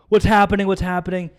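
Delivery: high, loud panicked repeat; re-enacted panic. Injured driver reliving the crash scene, re-enacted panic. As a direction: cry it out as if dazed and panicking.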